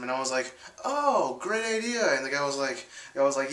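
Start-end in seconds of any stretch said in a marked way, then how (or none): 0.8-2.2 much higher, sing-song fake enthusiasm; mock sarcasm. Narrator faking agreement to the plan, high mock cheer. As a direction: say it with forced cheer. Narrator mocks his own reply.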